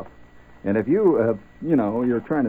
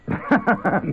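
short high giggle, real; burst of laughter; 9 semitones higher, 4 dB louder than usual. Real, not acted. Host laughs at the joke he won't tell, amused chuckle.

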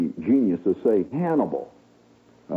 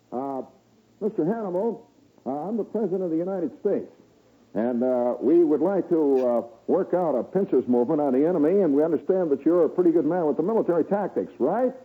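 high, fast folksy president; eager bumbling politician asking favors; 8 semitones higher than usual. Retold or imitated. President addressing the spirit.

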